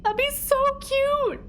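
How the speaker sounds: very high, squealed so cute; gushing. Real, not acted. Streamer squealing over her imagined bakery name. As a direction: squeal with delight.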